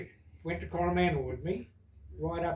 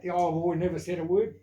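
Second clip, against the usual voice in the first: ordinary delivery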